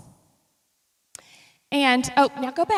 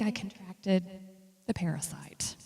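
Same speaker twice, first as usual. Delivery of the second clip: whisper; 3 semitones lower, 11 dB quieter than usual. Real, not acted. Confiding where she got sick.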